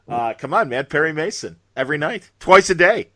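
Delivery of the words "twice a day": excited boast. Real, not acted. Gleeful boast about reruns.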